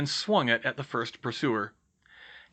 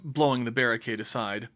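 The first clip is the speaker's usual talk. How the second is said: plain reading voice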